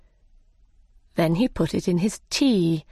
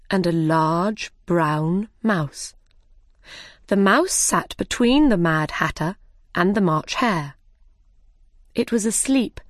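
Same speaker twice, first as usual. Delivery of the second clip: ordinary delivery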